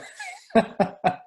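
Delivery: high breathy laugh; a quick laugh at yourself. Real, not acted. Guest joking the story is too long, laughing.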